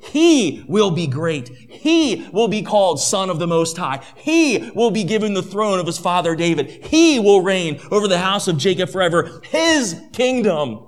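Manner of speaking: preacher driving home "He" and "His"; emphatic scripture reading. Acted. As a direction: stress on "He" and "His" opening each phrase. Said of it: "don't miss the emphasis there".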